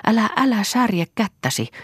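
high-pitched anxious plea; pleading. A narrator's reading. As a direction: beg him to stop, voice lifted high. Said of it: "pyysi Lea".

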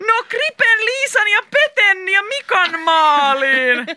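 high, loud, drawn-out cheer; triumphant shout. A narrator's reading. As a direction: celebrate the goal at full voice. Triumphant answer naming scoring team.

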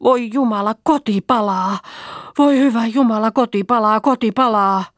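high, alarmed wail; panicked cry. A narrator's reading. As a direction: cry out in horror at a fire. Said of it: "Huutaen ja parkuen".

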